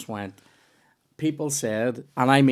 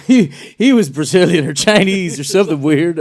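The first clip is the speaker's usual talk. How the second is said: louder, higher put-on movie-quote voice; Anchorman quote; 5 semitones higher, 8 dB louder than usual. Quoting Anchorman to a friend, louder put-on voice. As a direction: deliver it like a film character. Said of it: "that's from Anchorman".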